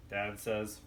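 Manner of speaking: plain reading voice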